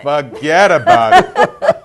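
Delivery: put-on slow, laughing New Jersey accent; mock Jersey girl, stretched out and giggling. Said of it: "A Jersey girl".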